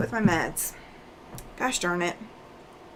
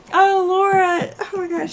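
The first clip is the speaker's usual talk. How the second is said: laughing exclamation; 9 semitones higher, 6 dB louder than usual. Real, not acted. Reacting to funny comment.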